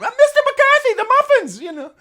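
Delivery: high, loud falsetto assistant; assistant in his ear. Retold or imitated. Assistants in the coach's ear, high loud falsetto nagging. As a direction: squeal it like a fussing aide. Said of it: "nine assistants in your ear going".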